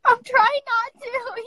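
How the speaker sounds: high, loud, slow tearful plea; fighting tears. Real, not acted. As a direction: voice breaking as she holds back crying. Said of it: "So you don't cry".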